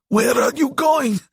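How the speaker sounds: very high, strained monster cry; desperate high-pitched creature; gasping demon's cry. A narrator's reading. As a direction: cry after him, short of air. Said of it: "Diavo cried, gasping for air".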